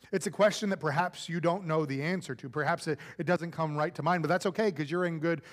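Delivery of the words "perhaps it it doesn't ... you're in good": ordinary talk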